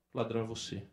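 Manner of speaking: low, soft confrontation; quiet, grave verdict; hushed accusation. Acted. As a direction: drop your voice to accuse the king. Prophet's grave accusation to the king.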